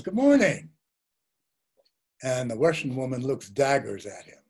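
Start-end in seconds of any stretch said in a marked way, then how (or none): 0.0-0.7 bright, much higher, louder friendly hello; cheerful greeting. Retold or imitated. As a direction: greet her with a big smile. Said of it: "This American man smiles and says".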